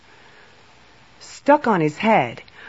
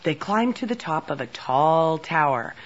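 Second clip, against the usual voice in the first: usual speaking voice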